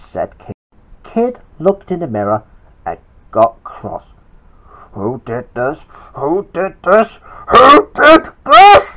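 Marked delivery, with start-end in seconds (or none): 4.9-8.9 building yell. Acted. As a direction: get angrier each time you repeat it. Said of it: "yelled Kid".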